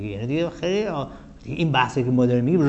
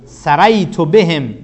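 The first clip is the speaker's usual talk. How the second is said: reciting verse; 5 dB louder, much slower than usual. Shown as a demonstration. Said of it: "صدر بیت این هستش".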